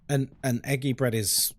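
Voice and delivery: usual speaking voice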